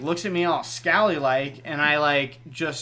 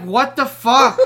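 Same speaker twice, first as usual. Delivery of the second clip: loud retold outrage; 7 semitones higher, 8 dB louder, slower than usual. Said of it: "he's like".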